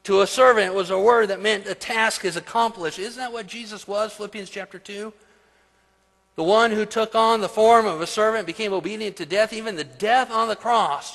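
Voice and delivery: high, loud preacher's proclamation; preaching. Acted. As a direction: preach it out with rising force. Preaches at full force.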